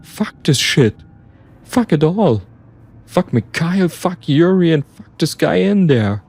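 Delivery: high-pitched, desperate defiance; frantic outburst. Acted. Narrator refusing to be a murderer, frantic. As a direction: curse it all in mounting panic.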